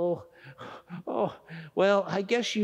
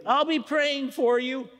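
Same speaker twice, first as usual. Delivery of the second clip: ordinary talk, nothing marked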